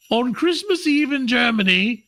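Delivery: high-pitched, shaky German accent attempt. Host telling a German Christmas legend, attempted German accent. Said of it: "I can't do a German accent".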